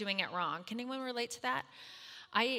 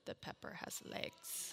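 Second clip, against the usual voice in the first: laughing under breath; 14 dB quieter, slower than usual. Retold or imitated. Husband's deadpan reveal at table.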